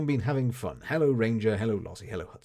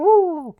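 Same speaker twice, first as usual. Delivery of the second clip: loud, high sustained hoot; owl hoot imitation; 16 dB louder than usual. Shown as a demonstration. Streamer retrying the owl impression, loud high hoot. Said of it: "I can do it".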